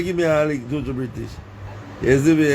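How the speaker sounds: ordinary talk, nothing marked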